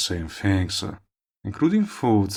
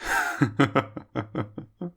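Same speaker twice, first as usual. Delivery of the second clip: amused laugh; 7 semitones higher than usual. Acted. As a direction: laugh it off, teasing. Villain amused by listener.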